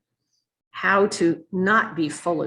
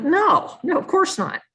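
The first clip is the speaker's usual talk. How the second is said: much higher and louder, insistent no; emphatic denial; 12 semitones higher, 3 dB louder than usual. Retold or imitated. Generals protecting their soldiers, a loud high protest. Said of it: "They're like".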